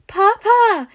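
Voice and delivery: put-on high, loud scream of a small boy; child's shriek. A narrator's reading. Little boy shrieking as his father is punched, high and loud. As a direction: shriek in alarm like a toddler. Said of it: "He hears Izuku shriek".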